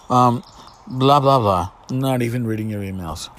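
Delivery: normal talking voice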